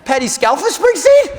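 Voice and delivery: high, loud incredulous question; acted stunned fan, pitched high; starstruck disbelief. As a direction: blurt the name in amazement. Starstruck on hearing who calls.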